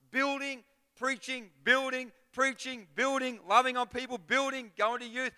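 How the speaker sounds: high, loud driving sermon cry; preacher rousing the congregation; fiery preaching. Acted. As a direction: build and hammer each by faith. Preaching at full force.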